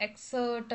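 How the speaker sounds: എക്സേർട്ട് with no p before the t; silent P. Shown as a demonstration. Teacher repeating the correction for students, എക്സേർട്ട് with silent P. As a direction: say എക്സേർട്ട് with the P dropped. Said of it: "എന്നാണ് pronounce ചെയ്യേണ്ടത്".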